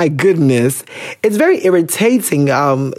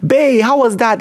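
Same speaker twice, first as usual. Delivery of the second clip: high mock girlfriend voice; imitated sweet-talking girlfriend, high-pitched; teasing girlfriend; 6 semitones higher, slower than usual. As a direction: pitch it up, pestering a partner playfully. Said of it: "what did she say?".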